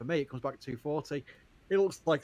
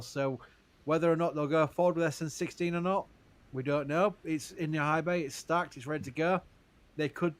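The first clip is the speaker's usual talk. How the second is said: ordinary talk, nothing marked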